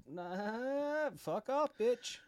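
high, sharp defiant dismissal; cocky high retort. Pretend. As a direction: brush them off, pitched high. Defiant retort to imagined challenger.

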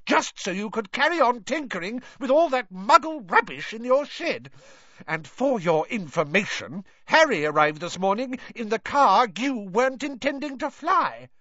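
angry shout. Acted. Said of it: "shouted Missus Weasley".

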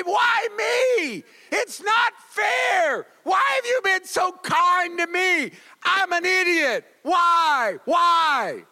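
high, loud hammed-up wail of why me; mock screaming at God. A blessed person screaming at God over a good day, mock high yell. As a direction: rail at heaven in pretend outrage. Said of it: "you started screaming at God".

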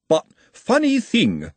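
a lightly voiced sly, nosy busybody; insinuate, fishing for a reaction; lightly voiced sly snoop; sly nosy watchman. Ottomy's voice.

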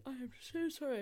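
talking through a yawn; words stretched by a yawn. Real, not acted. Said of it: "I keep yawning".

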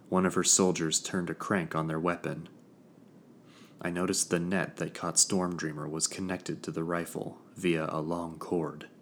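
usual speaking voice

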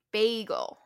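Bagel with a long AY, as in BAY; corrected pronunciation. Shown as a demonstration. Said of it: "say it as if it was B A Y".

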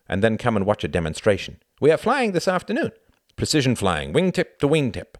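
high, emphatic invitation; showy pilot. A narrator's reading. Pilot inviting Tom to a demonstration, showy.

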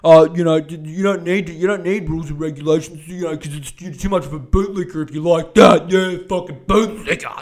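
loud, sneering mock troll voice; mocking Twitter troll. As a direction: lampoon a smug online contrarian, loudly. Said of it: "They're probably on Twitter being like".

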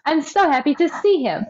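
high, fond mock owner greeting the dog; lightly voiced happy owner; doting owner. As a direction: coo it like a proud pet parent. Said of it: "and goes".

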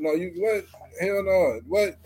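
normal talking voice

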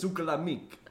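lightly imitated comic, a bit high; Joey Diaz. Repeating a favourite comedian's line, light imitation. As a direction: toss out the foreign-sounding catchphrase. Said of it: "No, Joey Diaz. He, he said".

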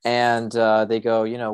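normal talking voice

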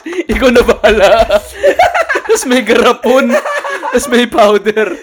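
talking through giggles; laughing while pitching the sponsor. Real, not acted. Joking about a sponsor deal. (natatawang nagsasalita)

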